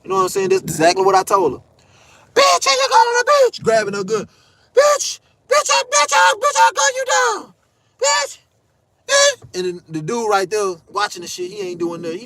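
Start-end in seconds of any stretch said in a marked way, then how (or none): 2.3-3.6 very high squealed imitation; mock shriek. Woman grabbing her gun, high mock shriek. 4.7-9.4 very high squealing imitation; hammed-up screech, threatening; mock shrieked threat. Woman threatening to gun him down, high mock shriek.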